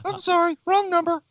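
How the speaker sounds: high put-on voice, a hint of a prank call; mock prank caller. [name] ends the bit like a prank caller, high mock voice. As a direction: hang up like a silly caller. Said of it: "Odd prank phone call".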